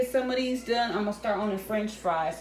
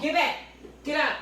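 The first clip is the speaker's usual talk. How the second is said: shooing command; 6 semitones higher than usual. Real, not acted. Mom shoos kid from stove.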